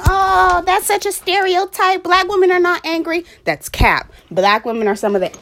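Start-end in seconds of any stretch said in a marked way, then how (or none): 0.1-3.3 high, loud mocking voice of an offended critic; scoffing high parody of a shocked objector; mocking objection. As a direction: mimic the indignant listener, pitched way up. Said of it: "I know you're thinking".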